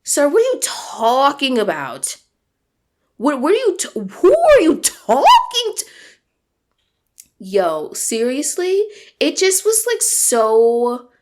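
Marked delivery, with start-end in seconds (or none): 0.0-5.8 incredulous. Retold or imitated. Telling her collaborator off over a message, rising to high outrage. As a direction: drag the last line up in outraged disbelief. Retelling her reply to Lucas.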